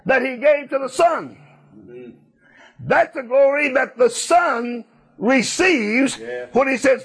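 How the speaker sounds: preacher lifting the congregation to a climax; fervent preaching. Acted. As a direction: preach it out with rising fire. Preaching builds to full force.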